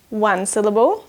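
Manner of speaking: normal talking voice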